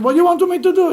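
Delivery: high, loud cry of helplessness; exasperated husband. Acted. Husband protests to his angry wife, high loud exasperation.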